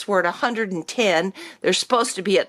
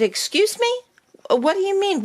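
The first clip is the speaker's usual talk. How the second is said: much higher, incredulous retort; indignant disbelief; 11 semitones higher than usual. Retold or imitated. Retelling her shocked comeback to the snotty doctor, incredulous. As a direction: throw his words back at him in disbelief.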